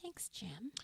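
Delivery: soft breathy whispered thanks. Real, not acted.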